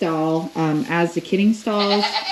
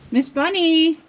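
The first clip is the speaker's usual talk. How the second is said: calling out; 10 semitones higher, 6 dB louder than usual. Real, not acted. Calling the goat.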